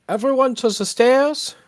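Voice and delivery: loud, high rallying shout; very loud call. Acted. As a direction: yell it out to the whole party. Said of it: "very loudly just say".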